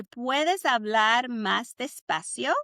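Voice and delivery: slowly, syllable by syllable; slow it down for practice; slow Spanish model again. Shown as a demonstration.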